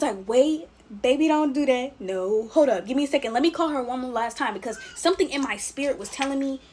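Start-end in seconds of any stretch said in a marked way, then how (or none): 0.3-4.5 urgent interruption. Retold or imitated. Niece stopping auntie from buying the hotel, urgent. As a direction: imitate yourself halting someone mid-action.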